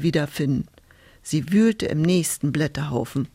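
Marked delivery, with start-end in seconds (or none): none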